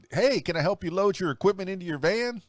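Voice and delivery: high-pitched mock-helpful offer; eager would-be helper. Volunteer offering to load the DJ's van, mock eager. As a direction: pipe up as an over-keen volunteer. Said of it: "I've had that question so many times".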